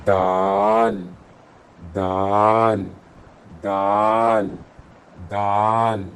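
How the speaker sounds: دال said gently, drawn out and slow; soft daal. Shown as a demonstration. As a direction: ease into دال softly. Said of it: "دال کو بہت نرمی سے ادا کرتے ہیں". (دال نرمی سے)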